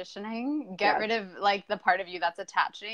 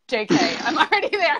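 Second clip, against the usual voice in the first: higher, louder, laughing the words; laughing client quote; 7 semitones higher, 8 dB louder than usual. Retold or imitated. Client joking about being already there, laughing. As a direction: giggle it like a delighted client. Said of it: "they're like".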